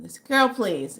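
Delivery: ordinary talk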